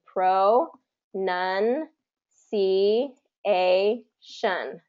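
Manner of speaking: very slow, syllable by syllable with pauses; long gaps between drawn-out syllables; broken apart. Shown as a demonstration. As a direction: isolate each syllable, as if teaching it. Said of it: "break it apart".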